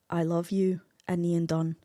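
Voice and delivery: put-on Scottish accent. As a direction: read it in a gentle Scottish accent. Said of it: "to read in a Scottish accent".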